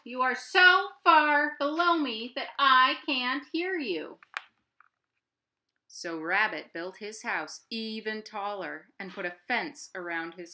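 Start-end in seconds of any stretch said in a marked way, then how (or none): 0.0-4.2 high, loud owl voice; screeching Owl. Acted. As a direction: screech across the distance. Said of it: "screeched Owl".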